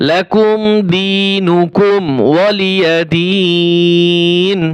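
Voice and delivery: slow, drawn-out melodic recitation; Quran recitation. Shown as a demonstration. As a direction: chant each word long and even. Recites the final verse. (ধীর সুরেলা তিলাওয়াত)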